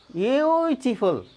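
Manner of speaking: ब्यूटीफुल slowly, with a drawn-out 'yoo'; slow model pronunciation. Shown as a demonstration. Teacher showing where यू sounds, slow stretched demo. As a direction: hold the first syllable of ब्यूटीफुल. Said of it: "देखिए यू उच्चारण आई".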